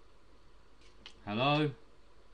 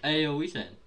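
ordinary delivery

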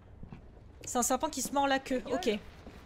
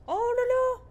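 much higher frightened "oh là là"; high scared cry; 10 semitones higher, 4 dB louder than usual. Real, not acted. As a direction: yelp it in fear. Frayeur devant le fantôme. ("oh là là" apeuré, très aigu)

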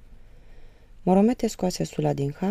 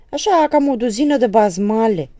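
loud, higher mock of the husband; wife mocking her husband's angry words, loud and higher; imitating his furious voice; 7 semitones higher, 12 dB louder than usual. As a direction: parrot him with contempt. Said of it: "îi imită ea glasul furios".

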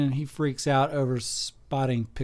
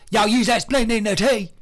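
higher, louder hammed-up Southern accent; Southern yokel voice; 8 semitones higher, 6 dB louder than usual. Shown as a demonstration. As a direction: push the Southern twang up and out. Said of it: "Maybe he talks like this".

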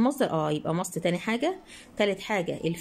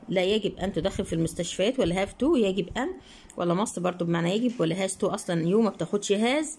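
normal talking voice

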